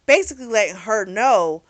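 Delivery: usual speaking voice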